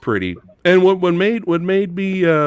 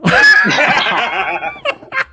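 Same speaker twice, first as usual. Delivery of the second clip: shrieking laugh; 5 dB louder than usual. Real, not acted. Laughs at mother-in-law's outburst.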